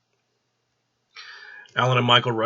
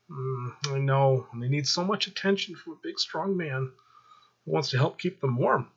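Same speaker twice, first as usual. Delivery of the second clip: seductive vixen routine; 5 semitones higher than usual. Acted. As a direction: play the pouting seductress. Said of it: "seductive sex vixen routine".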